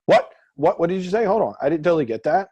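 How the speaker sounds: high, loud mock interruption; interrupting. Coach showing how people break rapport, abruptly interrupting. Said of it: "you interrupt, kind of, like".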